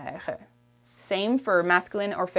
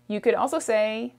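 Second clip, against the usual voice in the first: normal talking voice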